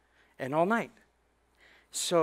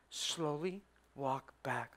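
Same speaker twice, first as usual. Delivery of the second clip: trainer's warning; 3 semitones lower, 12 dB quieter, slower than usual. Retold or imitated. Trainer's warning in tiger den.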